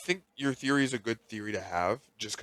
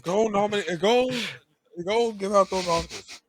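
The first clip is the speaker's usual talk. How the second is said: mock cheer; 8 semitones higher than usual. Mock cheering Oscar host.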